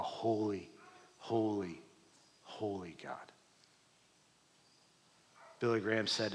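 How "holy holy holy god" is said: hushed reverence. Real, not acted. Repeating God's holiness.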